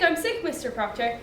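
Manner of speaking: put-on a frightened girl pleading under her breath; quiet plea. Girl begging her master not to hurt her, softly.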